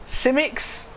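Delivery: Simics begun with a plain S sound; trying out pronunciation. Shown as a demonstration. Commentator guessing a driver's name, Simics said tentatively with S. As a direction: try Simics as two syllables, S first. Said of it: "or Zimix or Sisimics?".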